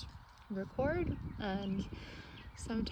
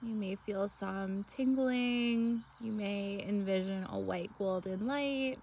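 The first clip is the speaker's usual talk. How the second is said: guided meditation. Acted. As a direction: lead them gently through breath and imagery, phrase by phrase.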